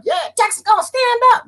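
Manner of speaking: high falsetto mock cheer; hammed-up high-pitched imitation; mocking hopeful newcomers. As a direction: squeal it like an overexcited believer. Mimicking people who moved to Texas.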